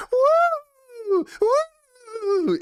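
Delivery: very high-pitched mimicked "woo"; imitated shouted whoop. Telling of client running down the street, imitated whooping shout. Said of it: "ran down the street shouting".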